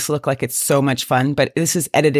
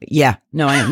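normal talking voice

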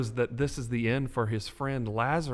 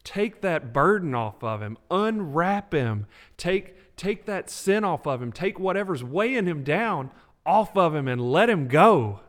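louder, rising preacher's cry; preaching at full force; 3 semitones higher, 6 dB louder than usual. Real, not acted. Podcast host preaching his own rescue, fervent build. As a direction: preach it, building with each line. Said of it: "start letting you preach".

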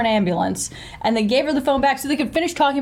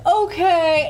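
mock breezy okay; 6 semitones higher than usual. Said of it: "so she's like".

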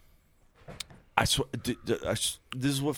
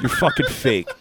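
laughing. Real, not acted. Teasing friend, laughing.

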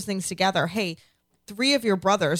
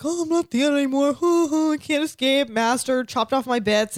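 higher, louder, slow, mock-pathetic whimper; 1 broken Theon; 7 semitones higher, 4 dB louder than usual. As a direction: play a broken, cowering prisoner. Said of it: "Theon's like".

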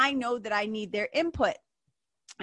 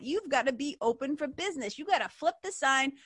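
ordinary talk

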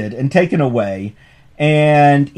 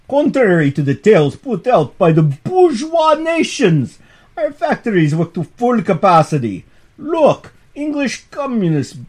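hammed-up Russian accent, raised and pushed, like a Bolshevik speechmaker; mock Russian accent; 6 semitones higher than usual. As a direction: read the Bolshevik's line in an exaggerated Russian accent. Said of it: "I'm reading in the accent".

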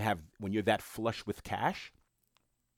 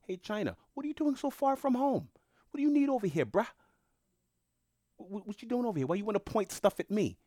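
much higher, taunting challenge with a bruh; mock street tough; 13 semitones higher, faster than usual. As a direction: confront China like a swaggering tough, half joking. US confronting China hypothetical.

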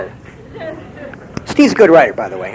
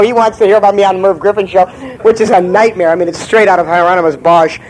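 ordinary talk, nothing marked

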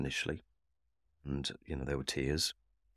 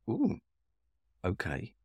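surprised ooh; 6 semitones higher, slower than usual. Retold or imitated. Surprised thought at the reaction.

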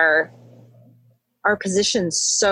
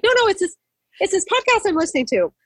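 high, protesting re-enacted explanation; flustered explaining; 12 semitones higher, faster than usual. Hiker explaining her tears to a stranger, flustered. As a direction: act out explaining yourself, pitched way up. Felt she had to explain herself.

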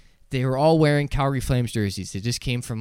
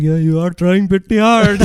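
loud, high, laughing impression; imitating amused agreement; 6 semitones higher, 10 dB louder than usual. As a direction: cheer the joke on, voice raised with laughter. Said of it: "I saw him laugh".

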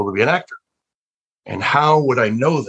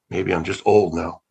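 normal talking voice

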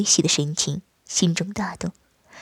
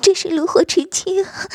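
wife crying out; 10 semitones higher than usual. Acted. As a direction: call out to him in a high voice, carried away. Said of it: "他叫声道".

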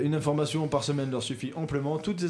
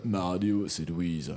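very low, deep, sinking voice; extremely deep bass voice; 9 semitones lower, 5 dB quieter, slower than usual. Shown as a demonstration. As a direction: drop the voice right down. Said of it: "Je sais faire un, une voix grave".

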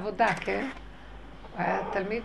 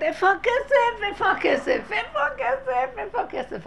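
distressed shouting; 11 semitones higher, 4 dB louder than usual. Retold or imitated. Widow at her daughter's wedding missing the dowry, crying out. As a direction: cry it out higher, over and over, imitating panic. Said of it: "והיא צועקת".

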